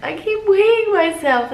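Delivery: puzzled high complaint; bewildered about leaking; high baffled complaint; high, loud complaint. Retold or imitated. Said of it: "I was like".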